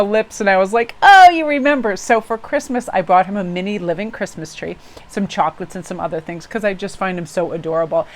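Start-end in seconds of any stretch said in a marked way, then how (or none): 1.0-2.0 retold happy exclamation, high and loud; delighted surprise. Customer to the cobbler who recognises her, delighted. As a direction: let the pitch leap up, thrilled to be remembered.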